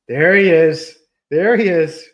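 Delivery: high, loud delighted pet praise; proud owner praising his puppy; praising the dog. Real, not acted. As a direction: cheer the dog on. Praising the dog after trick.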